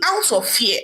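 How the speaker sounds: ordinary delivery, nothing marked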